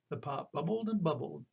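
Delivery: ordinary delivery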